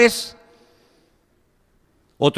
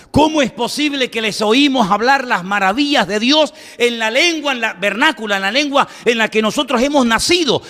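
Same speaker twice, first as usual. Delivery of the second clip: higher-pitched, incredulous questioning; preacher acting out astonishment; amazed crowd; 7 semitones higher than usual. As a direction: marvel aloud like an astonished onlooker. Astonished crowd voiced in sermon.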